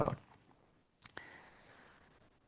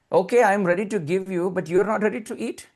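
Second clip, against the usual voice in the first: higher-pitched mock-scolding comeback; lightly acted, animated motherly protest; mother's retort; 7 semitones higher than usual. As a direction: turn the complaint back on the child. Mother turns complaint back on child.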